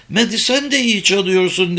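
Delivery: lightly voiced child; child character. A narrator's reading. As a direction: pitch it up as a young girl. Child character's line in narration.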